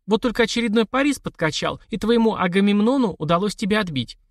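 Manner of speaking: high, playful mock-epic teasing; teasing society widow. Widow teases sister about kidnapping, high playful voice. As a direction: needle them with a grand tale.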